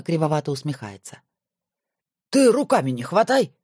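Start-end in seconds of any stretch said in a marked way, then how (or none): 2.3-3.5 higher jealous snap at a friend; jealous grumble. Acted. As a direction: warn him off her, jealously. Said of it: "ревниво буркнул Макаров". (ревниво, с обидой)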